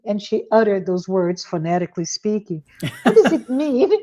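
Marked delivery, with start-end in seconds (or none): 3.0-3.9 high, laughing question; imitating the teacher through laughter; puzzled teacher voiced with a laugh; laughing while quoting. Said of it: "she said".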